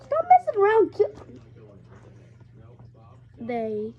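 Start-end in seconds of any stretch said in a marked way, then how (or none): 0.0-1.1 sudden loud, high telling-off; a real yell to quit fooling around; sharp scold. Real, not acted. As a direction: snap at them to stop. Scolding someone off-mic.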